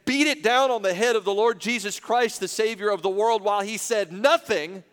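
impassioned pulpit delivery; full-force preaching. Real, not acted. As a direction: preach it out with mounting indignation.

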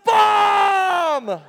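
very high, drawn-out shout; imitated child's full-volume holler; Travis. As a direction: yell the punchline like a gleeful kid, holding the last word. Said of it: "And he goes".

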